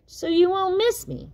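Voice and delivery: put-on high child's voice; a little one being sweet; sweet little Tad. Tad explains to his dad, high and sweet.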